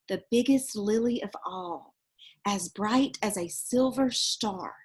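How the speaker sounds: put-on lively read-aloud voice for children; storybook reading. Reading a picture book aloud to children, lively storytelling. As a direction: tell it like a bedtime picture book.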